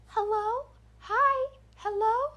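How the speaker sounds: put-on very high, eerie little-girl doll voice; spaced-out high greetings from a horror-movie doll; creepy doll. Voice circling the fence line, calling out in creepy doll voice. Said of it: "just like that, creepy doll voice".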